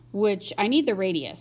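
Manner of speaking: ordinary talk, nothing marked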